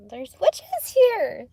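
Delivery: high, slow eerie warning; playful haunted-house voice; slow spooky voice. Pretend. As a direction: stretch it out like a spooky tale.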